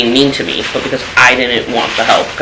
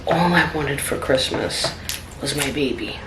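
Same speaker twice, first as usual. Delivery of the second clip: retold crying; 9 dB quieter than usual. Retelling to viewers her grief after losing the baby, quiet and near tears. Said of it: "I was hysterically crying".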